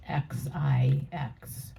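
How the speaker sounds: letters read out slowly one by one; slow spelled out. Real, not acted. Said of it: "That sounded differently".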